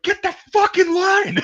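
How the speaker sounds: high, slow shouted order; drill sergeant yell. Retold or imitated. Sergeants dragging recruit back, high shouted order. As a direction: bark the order at him. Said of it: "they're like".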